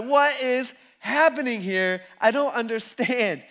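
baffled panic, played for laughs; acted freak-out. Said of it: "they are going crazy".

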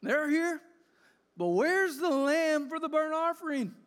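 put-on high, loud young voice asking urgently; a worried son asking his father where the lamb is; anxious boy Isaac.

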